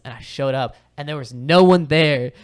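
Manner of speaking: ordinary talk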